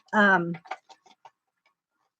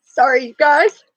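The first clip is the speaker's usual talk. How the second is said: higher, choked-up apology; getting emotional; 6 semitones higher than usual. Real, not acted. Vlogger thanking viewers, choked up and near tears. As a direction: voice close to tears, still pushing through. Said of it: "I'm getting emotional".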